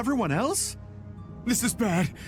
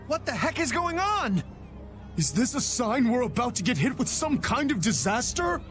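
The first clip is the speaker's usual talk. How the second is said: high-pitched, exasperated disbelief; worked-up, high-pitched protest; alarmed outburst; 7 semitones higher than usual. Acted. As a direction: cry out in indignant alarm. Alarmed outburst over coming disaster.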